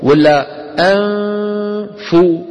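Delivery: أنفو with hum held then cut cleanly at فو, very slow; teacher's ghunna demo; correct ikhfa. Shown as a demonstration. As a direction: let the ghunna stop at the فاء in أنفو. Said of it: "ينقطع صوت الغنة".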